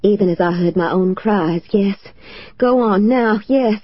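ordinary delivery, nothing marked

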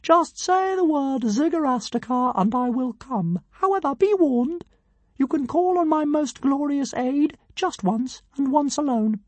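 pompous tiny dragon. A narrator's reading. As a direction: announce yourself like a pint-sized deity.

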